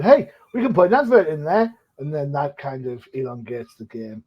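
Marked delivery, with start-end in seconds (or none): 0.0-1.7 higher, louder cheerful salesman pitch; eager network exec. Retold or imitated. Host mimics the network grabbing ad breaks, cheery exec voice. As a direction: sell it like a greedy TV exec. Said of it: "network said".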